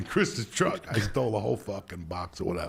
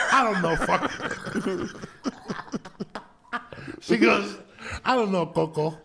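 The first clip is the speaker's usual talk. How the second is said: mimicking grandma; 9 semitones higher, 4 dB louder than usual. Grandma voiced in a story.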